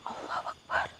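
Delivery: a voiceless, awed whisper. Real, not acted. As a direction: whisper it in shock. Whispered in shock.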